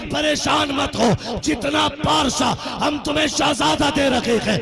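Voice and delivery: high ringing call from above. Acted. Voice from the Kaaba answering the grandfather, ringing call. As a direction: proclaim it like a voice from the Kaaba.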